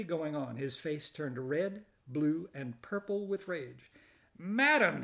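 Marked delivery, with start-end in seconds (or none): 4.4-5.0 high, loud indignant exclamation; outraged gasp. Acted. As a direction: sputter it out in outrage. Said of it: "he gasped".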